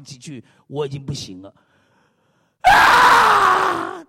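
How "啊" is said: sudden loud high wail breaking into tears; wailing cry. Retold or imitated. Pastor acting out how he burst into tears in church, loud wail. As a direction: let out a big sobbing howl. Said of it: "哭出来".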